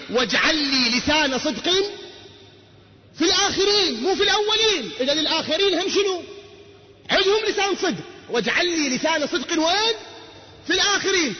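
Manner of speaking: high, ringing verse recital; soaring sermon recital; impassioned preaching. Acted. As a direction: lift the verse up to the crowd. Preaching verse at full force.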